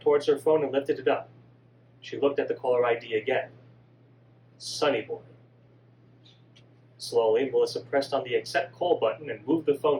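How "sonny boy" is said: ordinary delivery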